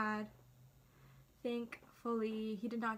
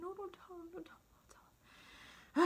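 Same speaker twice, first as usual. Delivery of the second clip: frantic hushed whisper, retold; whispered plea; 7 semitones higher, 4 dB quieter than usual. Nervous girl begging her sister not to tell dad, whispered. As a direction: whisper it urgently, pleading with someone beside you.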